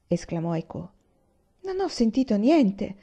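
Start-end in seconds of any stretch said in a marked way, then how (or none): 1.6-3.0 higher, halting stammer of a startled man. A narrator's reading. Aiwan stammers to his brother, a touch flustered. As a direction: stumble over the words, caught off guard. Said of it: "balbettò Aiwan".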